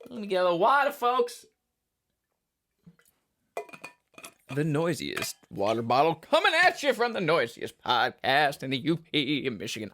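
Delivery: mock announcer. Hammed-up podcast announcer bit.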